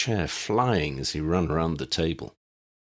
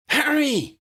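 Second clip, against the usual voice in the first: much higher, louder cry of his name; joyful shout; 5 dB louder than usual. Acted. Ginny cries Harry's name, high and louder. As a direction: shout it out as she runs to him.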